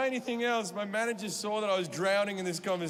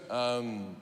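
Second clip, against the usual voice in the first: low, hesitant, lightly voiced confusion; confused Beatty; 8 semitones lower, slower than usual. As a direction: ask it baffled and hesitant.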